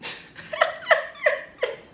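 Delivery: high airy burst of laughter; breathless laugh. Real, not acted. Laughing at how delusional she sounds, breathy.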